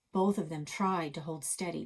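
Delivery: ordinary delivery, nothing marked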